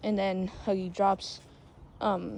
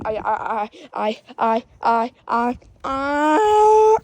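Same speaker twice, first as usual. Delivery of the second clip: mock stutter rising to a drawn-out cry; 4 semitones higher, 9 dB louder, slower than usual. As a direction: stammer building louder into a high wail. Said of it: "Dude, stop stuttering".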